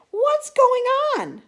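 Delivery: loud, high puzzled cry; astonished question. Acted. Astonished viewers at home cry out, loud and high. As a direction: exclaim in disbelief.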